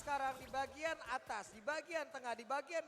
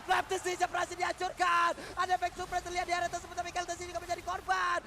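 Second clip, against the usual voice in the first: esports play-by-play; 5 semitones higher, 10 dB louder than usual. Real, not acted. Live play-by-play at full force.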